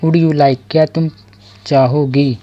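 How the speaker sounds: normal talking voice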